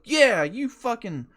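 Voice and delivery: hammed-up furious outburst at a driver who cut him off; retold road rage yell. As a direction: bellow the nonsense insult at a driver. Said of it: "that's what I was like".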